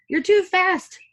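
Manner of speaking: high, loud complaint; fussy Bert. Acted. Bert complaining to Ernie at the sink, high and fussy. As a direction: fuss at him. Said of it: "said Bert".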